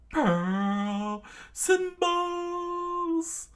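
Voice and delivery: high, slow, stretched battle cry; hammy anime attack shout; attack call. Acted. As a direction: yell the move name like a cartoon villain. Villain shouts his attack name.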